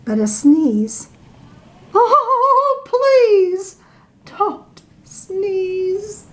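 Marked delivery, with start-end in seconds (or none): 1.9-6.2 high, drawn-out plea; pleading refrain. Acted. The narrator begs the elephant, high and pleading. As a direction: beg it, pitched high.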